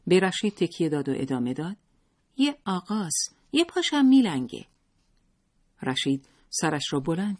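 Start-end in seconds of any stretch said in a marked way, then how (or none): 2.4-4.7 put-on high, innocent little boy; high child's voice, louder at the end. A narrator's reading. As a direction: blurt it out like a child. Child describing the visitor.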